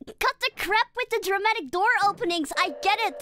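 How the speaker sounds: loud, high exasperated outburst; exasperated yell. Real, not acted. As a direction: yell at the game, fed up. Exasperated yelling at the game.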